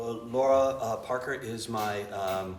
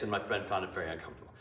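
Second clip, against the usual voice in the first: normal talking voice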